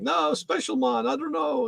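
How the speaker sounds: lightly put-on Jamaican accent, high; imitating the Jamaican mailman, high voice. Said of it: "So he got this Jamaican accent".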